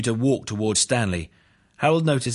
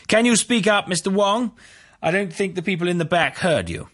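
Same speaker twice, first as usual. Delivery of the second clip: high, raised courtroom voice; projecting prosecutor; 11 semitones higher, 4 dB louder than usual. A narrator's reading. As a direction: pitch it up high for the whole room. Prosecutor demands witness speak up.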